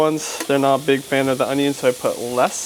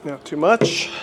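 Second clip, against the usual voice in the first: usual speaking voice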